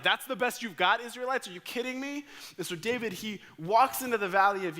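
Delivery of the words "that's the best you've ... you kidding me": high, scornful Philistine taunt; mocking jeer. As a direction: sneer across the valley at the Israelites. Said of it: "They were like".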